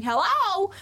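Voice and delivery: high, loud call into an empty ship. Retold or imitated. Jetpack guy calling across the abandoned ship, high and loud. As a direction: hail the deck as if no one is there.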